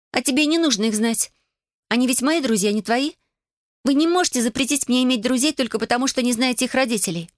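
put-on high-pitched, indignant girl's voice; exasperated teenage daughter. A narrator's reading. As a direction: argue back high and fed up. (возмущённый подросток, высоким голосом)

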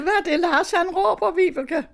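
high-pitched re-enacted phone question; acting out her own surprised question; retold startled question. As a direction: ask it high and urgently, as retold.

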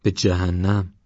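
understated disgust; a touch of contempt; hateful. A narrator's reading. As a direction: dismiss it with mild loathing. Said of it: "راننده با نفرت گفت".

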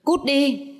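high scream, shrieking; terrified scream. A narrator's reading. Woman startled by the door, screaming at the intruder. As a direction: scream it out in sudden panic. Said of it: "Lệ Thủy vô thức hét lên".

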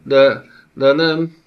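slow, halting "de, de nem", loud and high; pale stammer. Acted. Envoy stammers a denial to the giant lord. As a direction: stumble over the words in terror. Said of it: "hebegte sápadtan Aguf".

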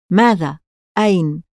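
plain reading voice

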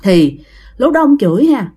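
ordinary delivery, nothing marked

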